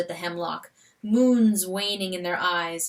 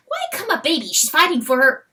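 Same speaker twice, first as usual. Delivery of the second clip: weasel voice; 6 semitones higher, 4 dB louder, faster than usual. Acted. Weasel musing.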